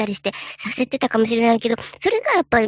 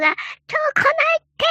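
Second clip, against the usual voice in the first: calling back from afar. Retold or imitated. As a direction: call it back to someone far away. Friend calls back from afar.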